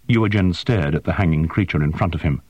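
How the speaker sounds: usual speaking voice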